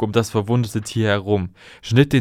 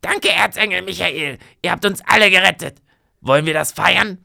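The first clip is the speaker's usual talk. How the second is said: high, bright-voiced thanks, louder at the rescue; young witch's voice; 9 semitones higher, 4 dB louder than usual. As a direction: thank the hero with eager gratitude. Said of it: "trat vor und sprach".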